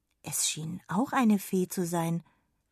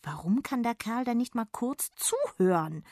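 annoyed; 5 semitones higher than usual. Acted. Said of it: "ärgerte sie sich".

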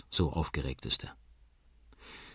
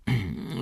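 short hesitant throat-clear; 2 semitones higher than usual. Acted. Uncle about to announce news to the parents, clearing his throat. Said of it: "räuspert sich Onkel Danny".

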